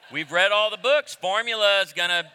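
loud, high storytelling build; loud preaching. Real, not acted. Pastor telling congregation the bottle story, loud and rising. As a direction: punch it out to the room.

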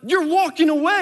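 put-on yelling across the room at a kid who walked off; retold angry shout. Dad in the kitchen scolding his son for leaving, shouting.

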